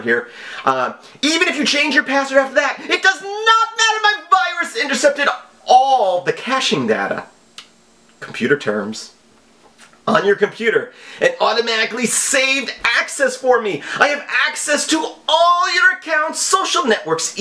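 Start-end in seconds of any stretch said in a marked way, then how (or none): 1.2-7.3 high, theatrical villain read of the email; mock scammer voice. Reading scam email in mock voice. 10.1-17.4 high, theatrical villain read of the email; mock scammer voice. Reading scam email in mock voice.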